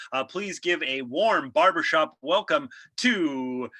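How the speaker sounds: host welcoming listeners to his hair show; cheesy podcast host. Acted. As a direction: slick show-host patter. Improv podcast host character.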